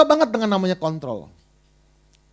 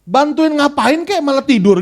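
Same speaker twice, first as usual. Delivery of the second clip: high, loud, slow nagging complaint; grumbling at God; 8 semitones higher, 9 dB louder, slower than usual. Retold or imitated. Disciples scolding sleeping Jesus, slow loud nagging complaint. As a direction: drag out the nag at the sleeping master. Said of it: "Mereka ngomel sama Tuhan".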